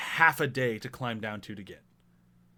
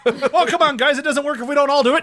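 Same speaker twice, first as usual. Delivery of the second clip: high, loud, fast whine through laughter; exasperated character; 12 semitones higher, 10 dB louder than usual. Retold or imitated. Quoting the character scolding the others, laughing whine. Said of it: "Just the".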